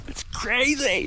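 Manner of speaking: high, slow laughing words. Real, not acted. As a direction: laugh through it in disbelief. Amazed at the deep snow.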